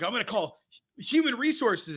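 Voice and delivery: imitating a wrestler's awkward delivery, pitched high; awkwardly delivered line. Fan quoting Jericho's clumsy line to co-host, mock awkward. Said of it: "delivered that line really awkwardly".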